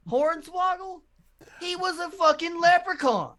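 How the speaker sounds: very high, loud exclaiming; excited insistence. Real, not acted. Correcting a co-host about Hornswoggle, high loud incredulous insistence. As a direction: squeal it in disbelief at your friend.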